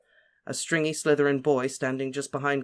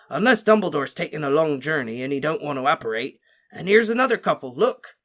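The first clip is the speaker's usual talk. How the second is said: hearty Hagrid; 3 semitones higher, 5 dB louder than usual. A narrator's reading. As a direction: reassure like a friendly gamekeeper. Hagrid voiced at length.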